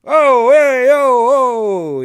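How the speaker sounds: very loud, high New York wiseguy ohs; hammed-up Italian-American New York hollering; New York mobster accent. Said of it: "like, the dude from The Sopranos".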